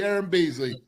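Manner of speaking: ordinary talk, nothing marked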